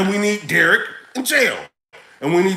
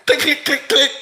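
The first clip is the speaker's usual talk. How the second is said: laughing lock clicks; 6 semitones higher than usual. Retold or imitated. Laughing imitation of locks.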